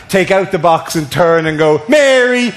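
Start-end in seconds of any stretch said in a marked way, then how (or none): 1.9-2.5 high, strained proposal; acted panicky declaration of love; desperate proposal. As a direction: blurt a proposal under duress. Forced proposal in airport security.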